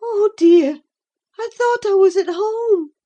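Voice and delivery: high, loud, sighing regret; sighed. A narrator's reading. Catherine ashamed after her fright, sighing. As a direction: sigh it out, ashamed. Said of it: "she sighed".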